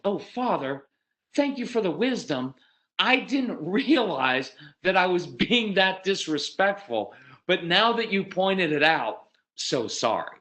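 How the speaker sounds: hammed-up dawning realization, drawn out; mock contrite child. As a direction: play an impossibly grateful child. Mock grateful child, sarcastic.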